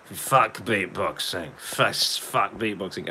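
put-on slow, dragged-out drunken slur; mock drunk. Retelling a drunk hotel recording, mock drunk. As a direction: imitate two drunks on a recording. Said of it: "getting really drunk".

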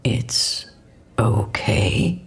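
low, hesitant reassurance; cautious, halting okay; tentative. A narrator's reading. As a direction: reassure carefully, feeling it out. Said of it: "his voice was tentative".

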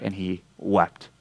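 hushed, heavy storytelling; solemn hush. A narrator's reading. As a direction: let the words sink into sorrow. Peter weeps, told solemnly.

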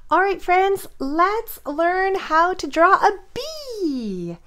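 high, slow, bright teacher welcome; cheery greeting. Real, not acted.

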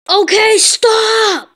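slow, loud drawn-out stop. Acted. A mom cutting off her son's rap, shouting. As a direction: shut the rap down hard.

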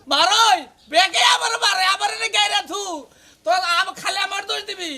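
high, loud shouting; outraged complaint. Acted. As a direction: cry out in protest at being hit. Crying out about being hit.

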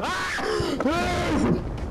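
sharp, very high scream of fright. Real, not acted. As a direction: shriek at the jump scare. Grita por un susto. (grito agudo de susto)

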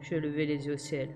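plain reading voice

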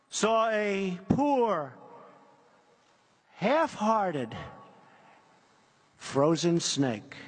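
slow, dramatic poem reading; poem recitation. Acted. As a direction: recite it like a fable to a crowd.

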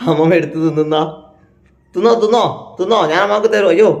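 high, loud urging to eat; loud coaxing. Real, not acted. Grandson urging grandma to eat, loud coaxing. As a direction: coax her loudly, repeating.

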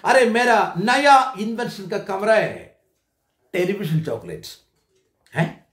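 ordinary delivery

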